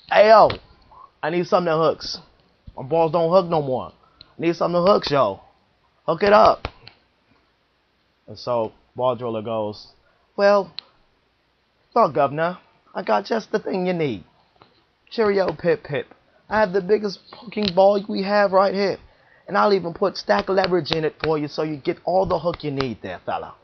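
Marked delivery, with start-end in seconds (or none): 0.1-6.7 loud, high street-style demand, yo; loud pushy customer. Acted. Customer loudly demanding a ball that hooks, pushy. 10.4-23.5 high, hammed-up British shopkeeper voice; mock British accent. Ball driller upsells the customer in a mock British accent.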